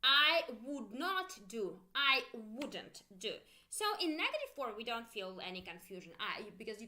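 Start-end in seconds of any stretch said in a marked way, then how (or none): 0.0-1.7 slow, louder "I would not do", each word separate; slow full form. Shown as a demonstration. Teacher drilling the uncontracted negative slowly. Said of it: "Make sure you pronounce with me". 1.9-3.3 slow "I wouldn't do", contraction said clearly. Shown as a demonstration. Teacher drilling the contracted negative slowly. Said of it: "Make sure you pronounce with me".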